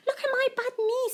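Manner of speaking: put-on high little girl voice; high-pitched child's "look"; child showing knee. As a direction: show off the knee like an excited child. Claire's repeated line.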